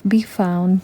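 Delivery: usual speaking voice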